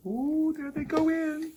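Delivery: high drawn-out ooh; delighted ooh. Real, not acted. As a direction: marvel at the cookies. Watching the cookies go in.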